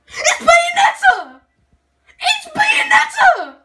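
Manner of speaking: very high, very loud screams of joy, slow and drawn out; ecstatic screaming. Real, not acted. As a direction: scream it over and over in disbelief. Fan screams at the character reveal.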